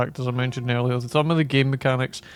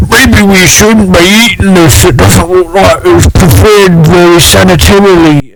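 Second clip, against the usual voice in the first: loud, higher put-on hero voice; 5 semitones higher, 17 dB louder than usual. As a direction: announce it like a fussy hero. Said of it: "they're like".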